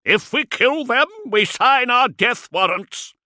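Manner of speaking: high, loud warning; urgent shout. A narrator's reading. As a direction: shout them down before they fire. Arguing against panicked attack.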